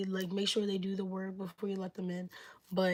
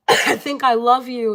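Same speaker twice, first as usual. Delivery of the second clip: blurted out loud and slow; loud love confession; 3 semitones higher, 13 dB louder than usual. Acted. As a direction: belt the confession out slowly, all in. Acted confession in tarot scenario.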